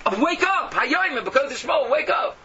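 loud, high cry to wake up; fiery exhortation. Real, not acted. The preacher crying out to his listeners, loud and high. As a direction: shout the call to wake up.

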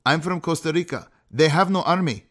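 ordinary talk

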